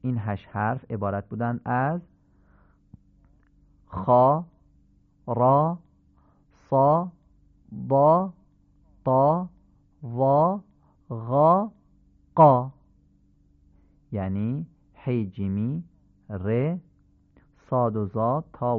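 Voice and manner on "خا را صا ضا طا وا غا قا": each of خا، را، صا، ضا، طا، وا، غا، قا with a short, full dark "aa"; heavy short fatha. Shown as a demonstration. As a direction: punch out each syllable from خا to قا alone, vowel like a short آ.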